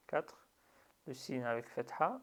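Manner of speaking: ordinary talk, nothing marked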